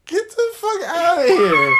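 high, loud, slow disbelieving yell; laughing shout. Real, not acted. Outraged laughing denial.

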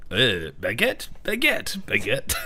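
mock French accent; playful French-accented practice; Ratatouille. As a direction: try out a French accent, varying the pitch each time. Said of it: "channel my inner Ratatouille".

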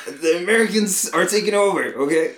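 high, loud mock alarm; mock outburst. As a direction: cry it out in joking protest. Mock outburst after teasing about America.

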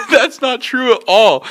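high, drawn-out disbelief; indignant denial. Retold or imitated. Deli worker denying customers' claim, high indignant protest. Retold denial of false accusation.

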